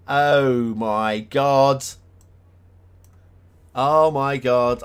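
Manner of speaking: loud, drawn-out astonishment; real amazement. Real, not acted. Streamer discovering a genius trick, loud slow amazement. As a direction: stretch each word out, loud and stunned.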